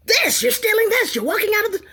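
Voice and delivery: high, loud mimicking of his accusation; mocking accuser. She mimics the employee accusing her, high and loud.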